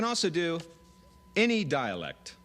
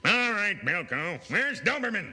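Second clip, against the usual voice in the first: shouting army colonel; 6 semitones higher, 4 dB louder than usual. Acted. Acted army sketch.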